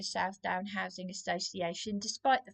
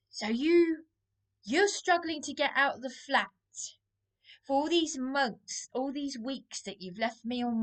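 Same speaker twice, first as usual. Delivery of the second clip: higher and louder, slowing on the last words; accusing outburst; 5 semitones higher, 3 dB louder than usual. Real, not acted. Confronting partner Darren about leaving her alone: angry outburst. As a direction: throw the accusation at him.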